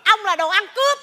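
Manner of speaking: heated accusation thrown back; indignant comic bickering; quarrelling retort. Acted. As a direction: fire the insult straight back. Acted couple trading accusations.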